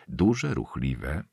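usual speaking voice

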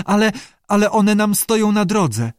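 high, frightened stammer; teeth chattering. A narrator's reading. Lee sees creatures blocking the way, teeth chattering. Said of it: "szczękała zębami Lee".

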